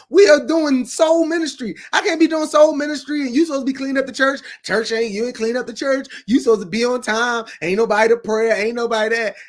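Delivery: loud, high-pitched indignant rant; hammed-up telling-off of church staff; acted scolding. Acts out a scolding church leader.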